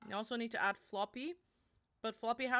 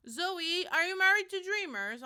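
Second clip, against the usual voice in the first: calling out; 8 semitones higher, 8 dB louder than usual. Real, not acted. Calling out to chat member.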